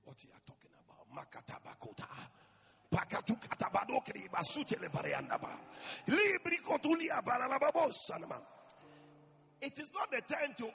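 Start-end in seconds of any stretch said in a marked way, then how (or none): 0.0-1.1 unvoiced hurried whisper. Real, not acted. Pastor dropping to a whisper about prayer. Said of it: "whispered aside". 1.2-8.4 speaking in tongues. Shown as a demonstration. Pastor demonstrating desperate prayer, in tongues. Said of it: "you pray as if you don't even know".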